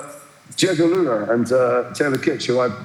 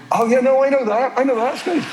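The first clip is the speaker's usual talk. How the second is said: much higher, falsely delighted; fake recognition; 11 semitones higher than usual. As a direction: gush as if you know them. Pretends to recognise the band.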